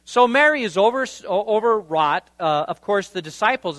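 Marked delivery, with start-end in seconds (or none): none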